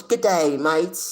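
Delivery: high, loud mock Australian accent; Aussie accent. Streamer greeting Alman in a put-on Australian accent. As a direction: holler a playful Aussie hello. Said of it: "The Aussies and their accents".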